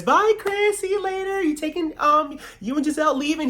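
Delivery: very high, sugary farewell voice; mock sweet Gizelle. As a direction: chirp it way up high like a fake-friendly goodbye. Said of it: "Gizelle's hugging on Chris".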